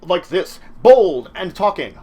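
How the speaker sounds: loud, bold and punchy; bold, loud game master voice; bold action voice. Shown as a demonstration. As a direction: boom it out like an action hero. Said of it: "if you could be like".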